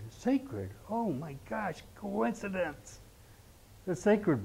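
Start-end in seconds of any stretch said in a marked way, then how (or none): 0.1-0.7 Sacred. said lower, settled and quiet; answering his own question; confirming. Shown as a demonstration. Confirms the word after guessing. 0.9-3.0 mock surprise. Sarcastic mock surprise about sacred bone.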